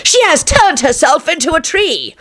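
high-pitched accusing screech; charlatan's scream. A narrator's reading. Charlatan exposing the witch to everyone, screaming. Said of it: "screamed the charlatan".

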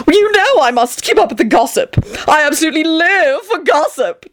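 high-pitched theatrical wail. A narrator's reading. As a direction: protest in melodramatic high whine. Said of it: "Tony wailed".

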